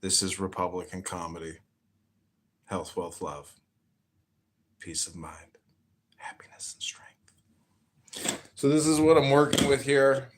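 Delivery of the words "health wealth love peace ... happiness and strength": whispering. Acted. Whispered blessing sign-off.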